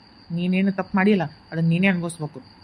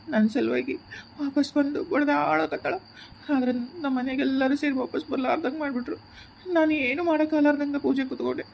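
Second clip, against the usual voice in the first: crying softly; 4 semitones higher, 4 dB quieter than usual. Acted. Man alone lamenting to lost Mohini, softly crying. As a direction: grieve quietly through tears. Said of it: "ಯಾಕ್ ಮಾವ ಅಳಕತ್ತಿದಿ?".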